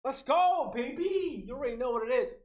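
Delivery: high hyped cheer; hype celebration. Real, not acted. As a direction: hype up the chat. Celebrating the milestone.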